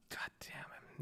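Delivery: a hissed aside to yourself; voiceless whispered swear; whispered curse. Real, not acted. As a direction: whisper it under your breath. Muttered curse under breath.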